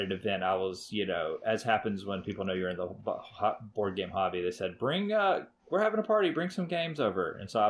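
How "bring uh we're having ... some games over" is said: high, bright party invite; imitating eager friends asking; excited invitation. Quoting friends inviting him.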